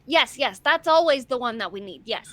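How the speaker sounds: loud, emphatic approval; gleeful, forceful agreement; eager picketer character. Acted. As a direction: cheer it on, loud and keyed up. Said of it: "Bitsy's like".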